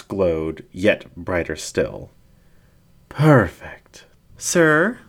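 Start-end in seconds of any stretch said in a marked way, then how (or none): 3.1-4.0 breathy whispered approval; whispered praise. Acted. Ringmaster savours the moment. 4.4-5.0 high, piping question; piped up. Acted. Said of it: "a voice piped up".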